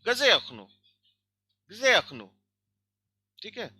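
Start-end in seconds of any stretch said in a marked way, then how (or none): 0.0-2.3 गजे hit high and loud, falling after; stress on गजे. Shown as a demonstration. Teacher demonstrating the Russian question intonation. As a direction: punch the question word गजे. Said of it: "So हम इसको कैसे पढ़ेंगे".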